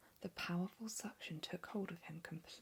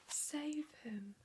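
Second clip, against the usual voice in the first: a higher whispered cry for help; whispered scream; 9 semitones higher than usual. Acted. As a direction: scream it, but keep it in a whisper. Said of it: "screamed Missus Gloop".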